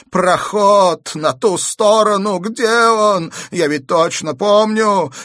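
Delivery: high, loud, urgent begging; pleading. A narrator's reading. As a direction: plead with desperate insistence. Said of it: "умоляющим тоном произнёс он". (громкая отчаянная мольба)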